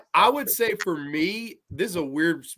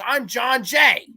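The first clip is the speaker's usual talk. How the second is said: slow, high mock yell; drawn-out, hammed-up holler; retold angry shout; 5 semitones higher, 4 dB louder, much slower than usual. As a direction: imitate an indignant man announcing himself. Acting out angry man shouting name.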